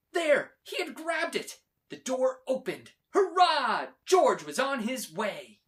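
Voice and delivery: loud, high triumphant narration; excited cheering. A narrator's reading. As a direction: cheer him on as he escapes. Narrator cheers George's escape.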